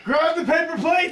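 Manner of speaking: high, slow battle order; commander shout. Pretend. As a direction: bark orders at the troops.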